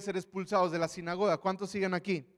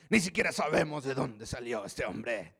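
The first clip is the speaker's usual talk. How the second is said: disdainful Pharisee; 4 semitones lower than usual. Acted. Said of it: "Yo creo que así hablaban".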